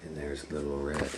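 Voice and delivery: usual speaking voice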